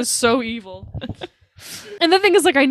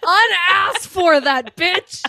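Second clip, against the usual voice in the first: high, laughing mock outrage; mock flipping out; 7 semitones higher, 3 dB louder, much slower than usual. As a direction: draw it out like a hammed-up outraged husband. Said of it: "And then he flips out".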